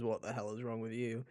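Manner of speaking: usual speaking voice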